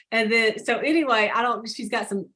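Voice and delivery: usual speaking voice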